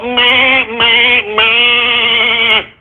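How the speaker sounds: a whiny, dismissive meh, meh, meh; mocking bleat. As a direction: bleat it like a sheep to mock him. Mocking the senator's gun-control plea.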